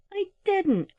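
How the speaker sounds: high-pitched, protesting denial; owlets crying out. A narrator's reading. Owlets denying blame to mother, high cry. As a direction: squeal it like an accused child. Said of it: "cried Screecher and Flipperty together".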